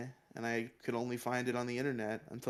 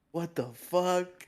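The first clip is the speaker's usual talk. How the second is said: stunned; 6 semitones higher, 6 dB louder, much slower than usual. Retold or imitated. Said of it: "I'm like".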